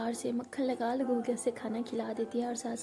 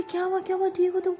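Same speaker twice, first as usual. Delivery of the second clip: higher, louder frightened calling; panicked worry; 7 semitones higher, 5 dB louder, faster than usual. Acted. Anu calling through the door to her hurt partner, frightened. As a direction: rush the words out in alarm. Hears partner scream in pain.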